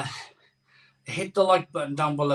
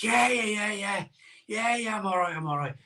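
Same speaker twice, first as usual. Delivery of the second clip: high, weak, fading yeahs; feeble mock reassurance; 7 semitones higher, 3 dB quieter than usual. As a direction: insist you're fine when clearly not. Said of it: "I was like".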